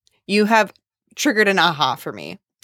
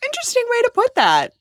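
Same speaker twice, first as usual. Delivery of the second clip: mock surprise; faster than usual. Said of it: "I was like".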